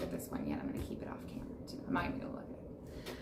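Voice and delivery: secretive whisper to herself; whispered aside. Real, not acted. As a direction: whisper as if hiding a card. Hiding a card off camera.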